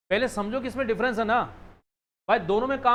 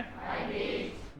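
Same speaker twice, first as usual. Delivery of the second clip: whispered echo; 10 dB quieter than usual. Shown as a demonstration. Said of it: "क्या बोला मैंने?".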